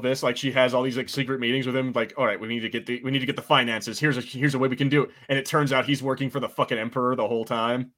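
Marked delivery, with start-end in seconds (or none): none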